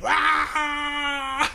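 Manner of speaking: high mock scream; imitated scream. Imitating the chef screaming at karaoke, high-pitched. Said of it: "starts going ... screaming".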